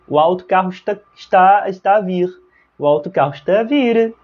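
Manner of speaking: light European Portuguese accent, "está" clipped to "stá". Shown as a demonstration. Teacher to students, mimicking a European Portuguese accent. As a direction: imitate a Portuguese speaker saying the bus is coming. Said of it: "Eles pronunciam autocarro".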